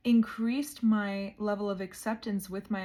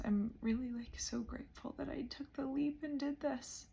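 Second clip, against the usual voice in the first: emotional, near tears; 4 semitones higher, 8 dB quieter than usual. Real, not acted. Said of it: "the gravity of this is hitting me".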